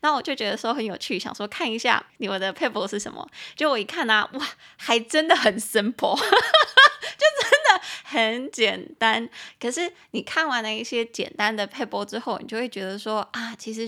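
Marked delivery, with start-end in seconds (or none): 4.3-9.3 laughing through the words; can't help laughing at how simple it is; giggling, louder. Real, not acted. Amused at how simple tips are.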